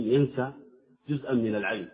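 ordinary talk

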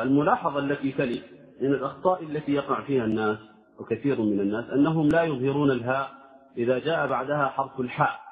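ordinary talk, nothing marked

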